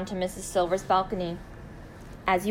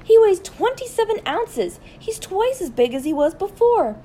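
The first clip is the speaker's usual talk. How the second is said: loud, very high-pitched jubilation; excited shouting; 12 semitones higher, 6 dB louder than usual. A narrator's reading. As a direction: shout the amazing news up to a neighbor. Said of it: "waving it above her head and shouting".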